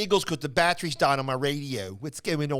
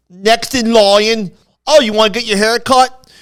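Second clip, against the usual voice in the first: loud, high Philadelphia hairdresser voice, drawn out; thick Philly accent; 6 semitones higher, 13 dB louder than usual. Retold or imitated. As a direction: put on a heavy Philly accent. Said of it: "Imagine my accent on steroids".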